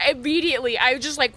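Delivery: ordinary delivery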